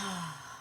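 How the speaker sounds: long, soft exhale; contented sigh. Real, not acted. Host taking a moment with listeners, long soft sigh. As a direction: let out a slow breath.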